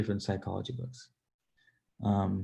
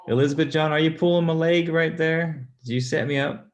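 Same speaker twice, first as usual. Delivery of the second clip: higher and louder, amused disbelief; playful surprise; 6 semitones higher, 7 dB louder than usual. Real, not acted. Streamer accuses a commenter of a setup, amused surprise. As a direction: call her out, laughing at the setup.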